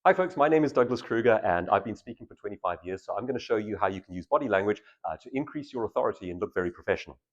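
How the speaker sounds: rushed, fast introduction with no pauses; hurried speaker intro; fast version. Shown as a demonstration. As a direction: race through it like you're nervous. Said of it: "So, fast version".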